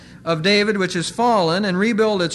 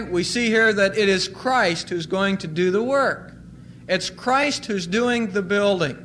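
ordinary talk, nothing marked

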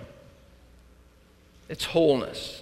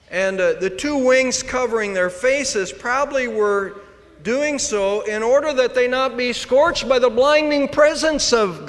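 higher and louder, preaching at full force; rising sermon fervor; 9 semitones higher, 5 dB louder than usual. Acted. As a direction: press the point home from the pulpit. Preacher building intensity.

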